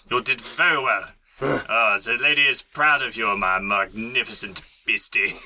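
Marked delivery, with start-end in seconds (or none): none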